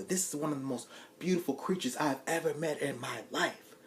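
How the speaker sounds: ordinary talk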